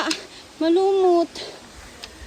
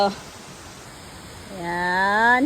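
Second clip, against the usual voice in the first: normal talking voice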